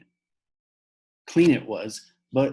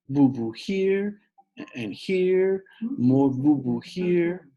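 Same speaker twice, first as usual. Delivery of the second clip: put-on slow little-kid voice; toddler voice; 7 semitones higher, 4 dB louder, much slower than usual. Fudge showing Peter his boo-boos, slow toddler voice. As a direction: show each scrape like a small child.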